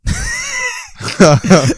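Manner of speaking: shrieking burst of laughter; high loud cackling laugh. Real, not acted. Co-host cracks up at alien.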